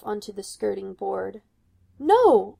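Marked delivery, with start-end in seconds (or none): 2.0-2.6 loud, high cry. A narrator's reading. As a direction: cry out to stop the knife. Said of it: "Rapunzel cries".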